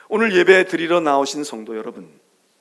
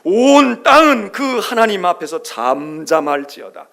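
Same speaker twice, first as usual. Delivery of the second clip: solemn scripture proclamation; 9 dB louder, slower than usual. Acted.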